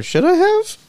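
very high, mocking echo; sarcastic mimicry. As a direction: mimic a cheeky, cowering question way up high. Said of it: "vaguely sarcastic and provocative on the other".

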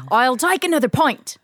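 a bad laughing Aussie pub order; mock Australian accent. Co-host guessing game, laughing mock Australian accent.

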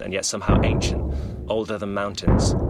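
ordinary delivery

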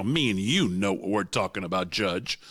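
lightly imitated chummy politician, pitched low; a hint of a patronizing senator; mock condescending politician. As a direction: confide smugly in the judge, half in character. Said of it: "when he's with, uh, Justice Thomas".

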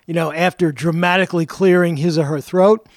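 ordinary delivery

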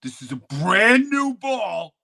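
high, loud and drawn out; announcing chips. Retold or imitated. Kid warning Jeffrey the ball costs money, high and slow. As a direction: spell out the price slowly to Jeffrey. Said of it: "I'm like".

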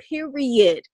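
usual speaking voice

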